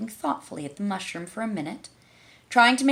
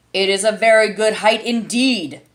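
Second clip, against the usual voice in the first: louder, offended caterpillar; angrily; 9 dB louder than usual. A narrator's reading. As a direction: bristle at the insult. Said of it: "said the caterpillar angrily".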